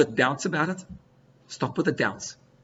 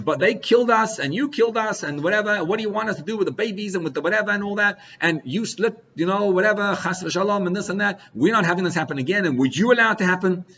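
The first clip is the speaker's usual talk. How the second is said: heated arguing; 6 semitones higher than usual. Retold or imitated. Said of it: "And he starts down and says".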